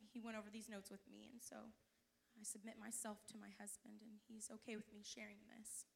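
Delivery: soft, breathy confiding aside; whispered aside. Real, not acted. Wife confiding to the church about her husband, whispered. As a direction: lean in and whisper it.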